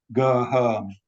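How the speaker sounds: "go home" with flat Yorkshire monophthongs; Yorkshire vowels, "go home" as "goh hohm". Shown as a demonstration. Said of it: "the home me, as a Yorkshire individual, was realized as".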